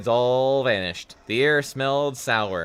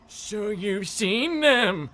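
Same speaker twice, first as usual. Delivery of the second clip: high, slow, drawn-out character voice; high, eerie old man; soft old man; 8 semitones higher, much slower than usual. A narrator's reading. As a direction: stretch it out in a high put-on voice. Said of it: "Mister Dawes said softly".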